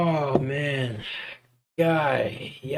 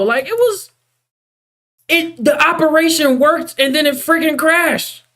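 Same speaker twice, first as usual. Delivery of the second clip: high-pitched rant; 13 semitones higher than usual. Real, not acted. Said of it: "more mad at Blender".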